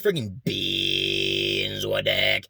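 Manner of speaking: slow, with a false-fold growl an octave below; half-hearted two-tone drone; doubled octave voice. Retold or imitated. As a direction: imitate the viral TikTok sound. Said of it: "That's how he made the sound".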